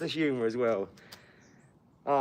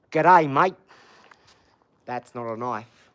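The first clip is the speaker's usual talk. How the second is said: mock Aussie accent; 8 dB louder, slower than usual. Streamer greeting an Aussie viewer, mock Australian accent. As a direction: put on a movie-Aussie accent. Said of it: "I don't know why we have to go to Crocodile Dundee".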